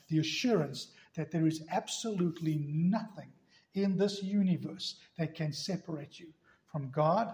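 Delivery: usual speaking voice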